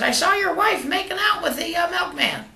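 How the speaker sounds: high squawky parrot voice. Acted. Joke teller voicing the parrot to its owner, high and squawky. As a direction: pitch way up like a talking bird.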